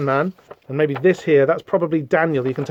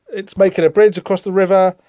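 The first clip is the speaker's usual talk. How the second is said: normal talking voice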